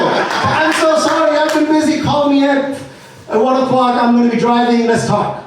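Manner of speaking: high, bubbly, apologetic caller voice with a laugh; imitating Nicole. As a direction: play her as breezy and sorry. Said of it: "and it was Nicole".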